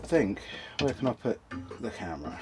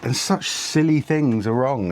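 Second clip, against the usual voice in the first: moaning; 7 semitones higher than usual. Real, not acted. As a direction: whinge about it, fed up. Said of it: "I've got to stop moaning".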